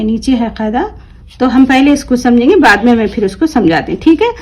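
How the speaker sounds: ordinary talk, nothing marked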